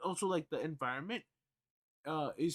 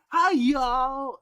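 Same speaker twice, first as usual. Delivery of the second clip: loud, high hammed-up Southern twang greeting; southern accent; 11 semitones higher, 16 dB louder than usual. Streamer mocking a newcomer to Antarctica, loud hammed-up Southern accent. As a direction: belt it like a cartoon Texan. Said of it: "speaking my southern accent".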